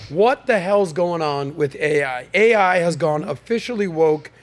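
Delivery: normal talking voice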